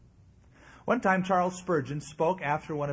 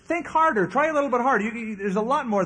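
much higher, loud defiant dare; bold challenge; 6 semitones higher, 6 dB louder than usual. Acted. Luther daring Satan to list more sins, high and loud. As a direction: throw it back at him, fearless. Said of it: "He said".

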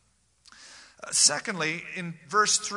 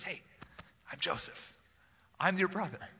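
kind and tender; 2 semitones higher, 6 dB quieter than usual. Shown as a demonstration. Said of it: "It was a kind and tender-hearted communication".

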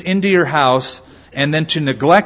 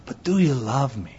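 softer, gentle, asked quietly; soft plea; 7 dB quieter than usual. Retold or imitated. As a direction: drop it to a tender, quiet question. Husband softly repeats his question.

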